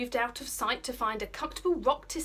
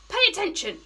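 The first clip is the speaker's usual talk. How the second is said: sharp, much higher and louder command; hissed order; 10 semitones higher, 9 dB louder, faster than usual. Acted. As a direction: snap it at the boys. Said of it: "hissed Snotlout".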